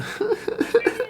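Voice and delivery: high-pitched fake bawling; squeaky, pretend sobs; mock sobbing. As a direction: imitate sudden crying, hammed up. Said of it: "just starts crying".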